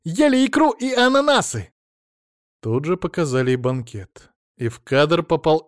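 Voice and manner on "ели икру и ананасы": high, loud indignant tirade; screamed accusations. A narrator's reading. Mother-in-law accuses him of deceit and feasting, shrieked tirade. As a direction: shriek each charge higher. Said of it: "закричала она".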